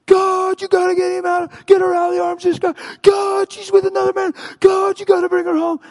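high, frantic screamed pleading; full-voiced acted meltdown, loud and high; freaking out. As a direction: scream at God in desperation. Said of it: "He's just freaking out".

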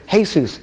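Jesus said hay-SOOS, Spanish style; Spanish name. Retold or imitated. Cashier correcting his name to hay-SOOS. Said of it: "He goes".

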